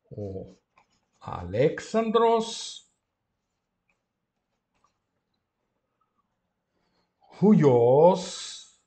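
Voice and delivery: palavra a palavra, muito devagar; ditado lento. Shown as a demonstration. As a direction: dite a frase para os alunos escreverem. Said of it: "dictating sentence slowly". (o professor dita a frase grega à turma, muito devagar)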